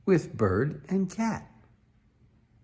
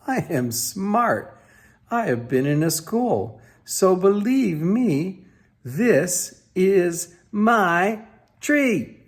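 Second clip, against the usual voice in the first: higher, slow and smug, a lightly voiced proud fish; boastful Fish voice; 5 semitones higher, 4 dB louder, slower than usual. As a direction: show off your tree like a proud little fish. Mister Fish boasting up tree.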